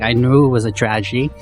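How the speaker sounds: normal talking voice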